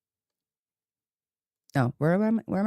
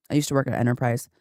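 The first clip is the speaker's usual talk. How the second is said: normal talking voice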